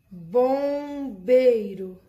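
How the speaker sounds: teacher stretches the word so students hear the vowel plus M; drawn out example. Shown as a demonstration. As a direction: draw out each syllable of Bombeiro. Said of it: "Três palavras com a vogal mais M".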